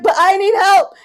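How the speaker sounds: loud, high mock whine; whiny plea. Speaker mocking herself pleading to Govinda, loud whine. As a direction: plead like a stubborn child. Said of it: "And I'm like".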